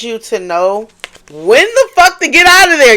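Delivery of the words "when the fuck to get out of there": much higher and louder, bursting out; excited outburst. Real, not acted. Reader hyped telling viewers how they escaped, excited yell. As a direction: blurt it with a rush of excitement.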